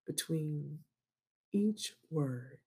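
"between" and "each word" slow with long pauses. Shown as a demonstration. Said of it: "they pause".

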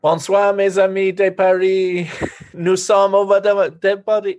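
high-pitched retold stage greeting; stage introduction in French. Singer introducing the band to Paris, high stage greeting. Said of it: "introduced the band, like".

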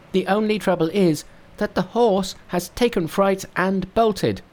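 high, bright-pitched monkey king. Acted. Narrator voicing Monkey to his master, high monkey voice. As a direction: play the cocky monkey hero up high.

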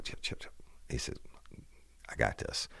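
hushed whisper, no voice at all. Retold or imitated. Harry whispering to his partner in front of the armed man, hushed whisper. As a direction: breathe the words so the gunman can't hear.

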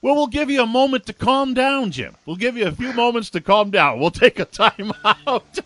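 high-pitched, laughing tease; amused teasing. Real, not acted. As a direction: tease an overexcited partner, voice lifted with laughter. Amused host teases excited co-host.

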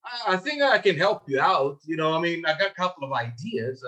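noncommittal, hedging pitch; unsure seller. Acted. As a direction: imitate a seller with no conviction. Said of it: "completely different from somebody who's like".

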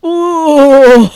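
loud disgusted ooh; grossed-out ooh. Real, not acted. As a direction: recoil with a drawn-out "ooh". Groaning at gross cilia description.

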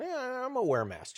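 lightly imitating a meek excuse; cautious patient. Transplant patient explains wearing a mask, mild and cautious. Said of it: "a pancreas transplant or something going".

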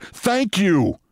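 high, curt annoyed thanks. Retold or imitated. Deputy mayor thanking the mayor with annoyance. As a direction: snap it out, fed up. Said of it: "With annoyance".

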